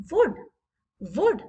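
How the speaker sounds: "Would" said short, without dragging the vowel; "Would" in one quick short beat; short vowel, undragged. Shown as a demonstration. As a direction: keep the oo in "Would" brief. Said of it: "ज़्यादा हम drag नहीं कर रहे हैं".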